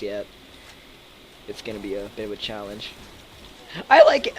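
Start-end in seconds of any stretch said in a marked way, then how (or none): 3.9-4.3 real accidental voice break; voice crack. Real, not acted. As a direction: voice cracks up high mid-word. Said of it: "Wow, voice crack there".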